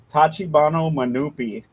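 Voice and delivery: usual speaking voice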